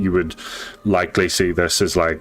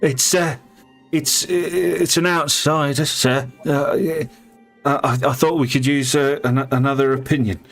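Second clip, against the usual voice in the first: higher, quick, nervous plea to chief; higher pleading shaman voice; 7 semitones higher than usual. Acted.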